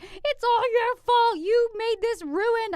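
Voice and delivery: loud, high, drawn-out mock tantrum; imitating angry child. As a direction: wail the blame like a furious little girl. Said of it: "She got mad and pissed".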